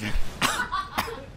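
laughing with a mouth full of gum; real muffled laughing fit; helpless laugh. Real, not acted. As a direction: crack up at your own mess. Cracking up over the gum.

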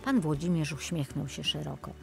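plain reading voice

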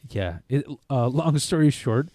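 usual speaking voice

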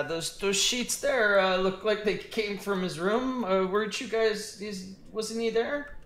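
high, slow, hesitant goblin squeak; a nervous goblin pointing out the sheets. Acted. Goblin answering the party, high and halting. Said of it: "he's like".

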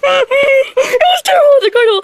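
high, slow wailing sob; wailing cry. Pretend. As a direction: cry it out like a hurt kid. Wailing about horse bite.

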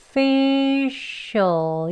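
facial stretched as fi-shul, to show the join; syllables linked slowly. Shown as a demonstration. Teacher links last two syllables, facial long and drawn out. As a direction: draw out "facial" as fiii-shul, slowly linking both parts. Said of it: "네 번째만 연결해보면요".

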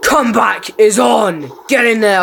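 loud high celebration; excited cheer. Real, not acted. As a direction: whoop at the kill. Gamer celebrating a kill.

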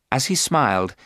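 usual speaking voice

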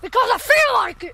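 loud high shout; defiant shout. Real, not acted. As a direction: yell it back defiantly.

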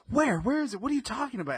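very high, soft-volume panicked wife; imitated freak-out. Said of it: "freaking out".

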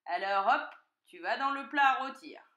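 loud, lively fox order; brisk command. A narrator's reading. Fox sending piglet to the roasting dish, loud command. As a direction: order it loudly, with relish.

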